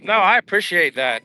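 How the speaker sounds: ordinary talk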